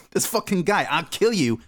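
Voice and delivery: high, loud mock-mobster accent; badly faked New York Italian accent; bad Italian-American accent. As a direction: threaten like a cartoon Sopranos gangster. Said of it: "That's not an Italian accent".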